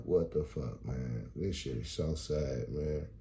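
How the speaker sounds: low, softened, deflated grumble; mock dejection. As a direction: let it sink low, mock-mournful and defeated. Laments the lost beers.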